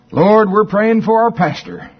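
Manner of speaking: high, loud congregation praying in jest; joking mock prayer. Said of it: "they did it like a joke".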